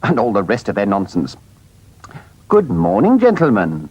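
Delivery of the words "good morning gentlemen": ordinary delivery, nothing marked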